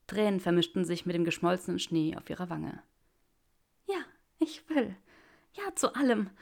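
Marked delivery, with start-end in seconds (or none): 3.9-6.3 higher, louder, tearful joy; Granger's joyful yes. Acted. Granger answers Draco's proposal, higher and overjoyed. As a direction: pour out yes after yes, higher.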